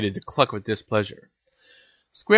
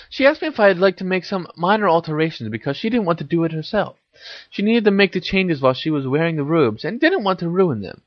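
put-on higher-pitched girl character recounting; Hermione, explaining; 7 semitones higher than usual. A narrator's reading. Hermione tells Harry about the robe fitting, explaining in higher voice.

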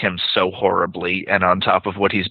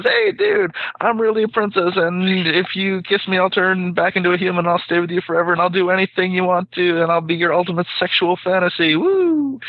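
much higher, squeaky and eager frog; 10 semitones higher than usual. Acted. Talking frog pitches itself to the guy again, high squeaky voice. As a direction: push it up high, selling the deal, ending in a whoop. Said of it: "the frog says".

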